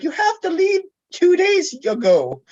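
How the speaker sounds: high, frantic mom voice; imitating panicked mom. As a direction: squeal it like a worried mother. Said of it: "She's like".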